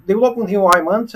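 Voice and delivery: ordinary talk, nothing marked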